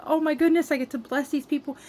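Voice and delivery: high, bright burst of joy; happy excitement. Retold or imitated. Telling viewers how thrilled she was, high and excited. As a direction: gush with delight at the chance. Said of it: "I was just so happy".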